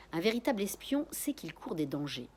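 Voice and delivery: ordinary delivery, nothing marked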